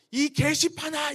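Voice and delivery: put-on teen cursing at the cross, slow loud yell; yelling a curse. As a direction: shout it slowly at the cross. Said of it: "이렇게 소리를 질렀어요".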